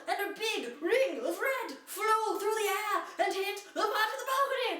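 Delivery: building thrill in the telling; excited narration. A narrator's reading. Narrator caught up in the balcony crash, excited.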